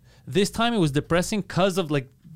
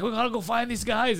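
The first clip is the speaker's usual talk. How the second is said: put-on drunk woman voice; 8 semitones higher than usual. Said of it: "she's like".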